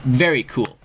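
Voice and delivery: normal talking voice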